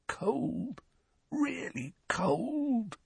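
very slow, soft high croak. A narrator's reading. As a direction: croak it out, drawn out. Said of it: "croaked Dudley".

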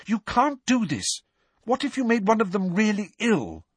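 high-pitched, urgent objection; worked-up protest. A narrator's reading. Hermione fears the first years get ill, worked up. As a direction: plead crossly at a high pitch.

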